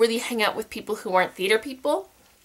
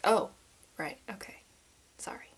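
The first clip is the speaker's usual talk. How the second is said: very soft, near-whispered embarrassed retreat; sheepish mumble; 3 semitones lower, 10 dB quieter than usual. Retold or imitated. Teller retreating after friends looked at her funny, sheepish and hushed. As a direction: back down meekly, barely audible.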